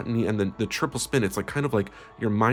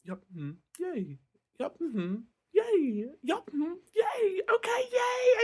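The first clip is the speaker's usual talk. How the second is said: starting much softer and climbing to a much higher tearful shout; forced psych-up; 3 dB quieter, slower than usual. Acted. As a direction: cheer yourself up through tears, building. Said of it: "I feel so much better now".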